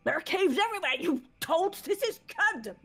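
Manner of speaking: high, loud scientist rant; mad scientist outburst. Acted. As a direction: yell at the intruders, ranting. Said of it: "my mad scientist voice".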